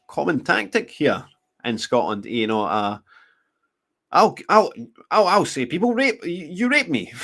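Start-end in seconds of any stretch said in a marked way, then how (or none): 4.1-7.1 mock accusation. Acting out a threatened false accusation.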